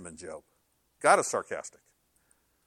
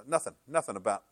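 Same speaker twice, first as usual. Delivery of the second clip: ordinary talk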